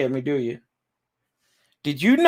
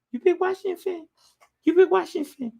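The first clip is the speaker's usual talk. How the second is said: pet talk; 10 semitones higher, 4 dB quieter, faster than usual. Real, not acted. Baby talk to his pet dog.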